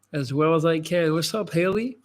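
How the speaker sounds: ordinary delivery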